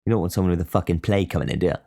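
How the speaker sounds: normal talking voice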